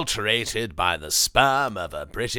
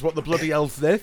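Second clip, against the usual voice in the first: put-on boxer's voice, slightly higher; Chris Eubank; 4 semitones higher than usual. Mimicking a boxer for a laugh, mock exclamation. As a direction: imitate a famous boxer, pitched up a touch. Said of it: "Chris, he does. He says".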